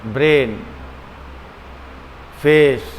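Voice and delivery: ordinary talk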